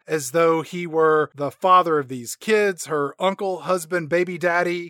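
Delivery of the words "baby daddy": ordinary talk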